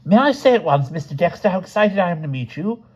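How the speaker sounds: high, excited, fawning admirer; gushing Crabbin. A narrator's reading. Crabbin gushes to the supposed Dexter about meeting him, high and eager. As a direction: gush over the famous novelist.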